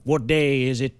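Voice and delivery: a dazed man snapping out a question; demanding. A narrator's reading. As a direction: push the question hard. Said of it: "he demanded".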